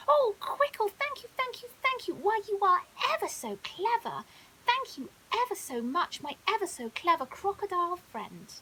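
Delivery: delighted lion thanking the crocodile; overjoyed. Acted. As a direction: burst with joy and gratitude. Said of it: "he was overjoyed".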